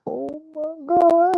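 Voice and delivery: high, drawn-out, squeaky disbelief; shocked squeal. Retold or imitated. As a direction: let it slip out slowly in a high squeak of shock. His shocked reaction, retold.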